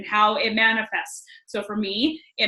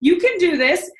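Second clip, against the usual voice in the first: higher, louder, punchy encouragement; emphatic pep talk; 7 semitones higher, 4 dB louder than usual. Retold or imitated. Author urging his reader on, emphatic pep talk. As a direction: drive each short phrase home like a motivational coach. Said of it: "thing that he goes".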